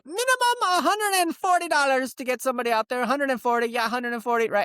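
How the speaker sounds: high pushy disguised dispatcher; fake voice. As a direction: push the price as a different operator. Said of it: "the amount of voices I had to come up with".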